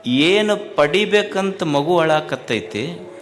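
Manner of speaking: loud, impassioned sermon voice building up; preaching at full force. Acted. Swami urging the gathered devotees, loud and impassioned. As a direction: preach it out to the whole crowd.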